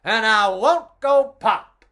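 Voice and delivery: defiant little sausage voice; loud, stubborn sausage. Acted. Sausage voice in read story.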